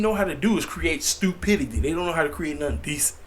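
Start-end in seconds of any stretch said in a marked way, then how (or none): none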